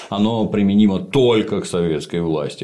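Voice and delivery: ordinary talk, nothing marked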